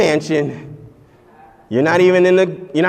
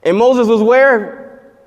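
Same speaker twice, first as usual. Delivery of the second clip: drawn-out question; 6 semitones higher, 4 dB louder, much slower than usual. Real, not acted. Preacher quizzing congregation.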